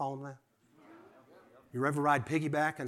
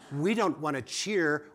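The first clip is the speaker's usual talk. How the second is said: ordinary talk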